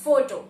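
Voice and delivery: photo said with a long o, foe-toe. Shown as a demonstration. Teacher modelling the word photo. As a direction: say photo as foe-toe, stressing the first syllable. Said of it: "we say".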